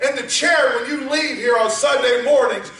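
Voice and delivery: rising, shouted sermon climax; preaching at full force. Acted. Exhorting the congregation.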